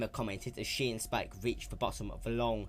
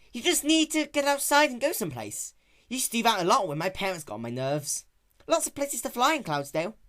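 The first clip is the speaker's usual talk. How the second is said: raised, brash tomboy pony voice; lightly raised young heroine; Rainbow Dash voice; 12 semitones higher, 7 dB louder than usual. Acted. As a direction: play her sporty and cocky.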